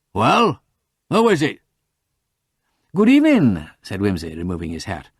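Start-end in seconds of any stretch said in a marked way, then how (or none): 0.1-1.6 higher, louder impatient demand; impatient growl. A narrator's reading. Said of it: "growled impatiently". 2.9-3.7 high, louder genteel greeting; polite caller. A narrator's reading. Visitor politely hails gruff household.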